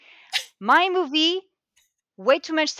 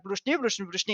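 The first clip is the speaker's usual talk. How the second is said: mock Russian gibberish; 3 semitones lower, 7 dB quieter, faster than usual. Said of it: "you've got Russian people, and they're like".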